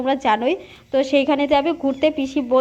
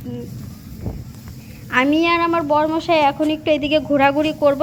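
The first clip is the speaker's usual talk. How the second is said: usual speaking voice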